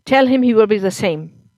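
put-on loud, bitter drunk husband; drunk angry husband. A narrator's reading.

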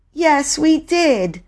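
high, drawn-out insistence; slow, high girl's retort; insistent contradiction. Acted. Bobby contradicts her brother.

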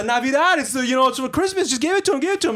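high-pitched imitation of wheedling; insistent pleading. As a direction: plead the holiday, mimicking the customer. Retold customer pleading for Christmas exception.

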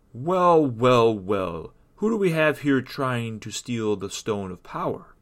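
loud, drawn-out taunting entrance; booming mysterious protector; menacing guardian. Acted. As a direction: greet the intruder with looming, mocking relish. Mysterious guardian catches Rita stealing.

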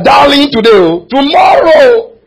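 slow, drawn-out, loud wail on tomorrow; anguished cry. Real, not acted. Pastor warning the church that favour turns, crying out. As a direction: stretch it like a lament.

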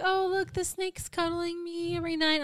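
high, cooing mock-innocent voice; mimicked sweet obliviousness, pitched high; naive snake owner. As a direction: gush like a clueless pet owner.

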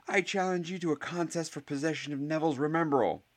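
high, sustained proclamation; scream. A narrator's reading. Harry challenges Goyle to a contest, high cry. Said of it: "screamed Harry".

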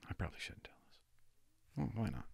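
whispered aside, no voice at all. Real, not acted. Confiding to the audience he shouldn't tell this, whispered. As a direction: lean in and breathe it unvoiced. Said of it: "aside to audience".

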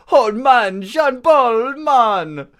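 hollered Jamaican-style catchphrase; Jamaican accent. Shown as a demonstration. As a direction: demonstrate an exaggerated Jamaican accent. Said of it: "That, that's Jamaican".

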